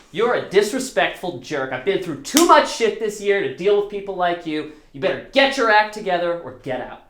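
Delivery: mock the angry roommate's note; angry letter. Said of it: "it just goes".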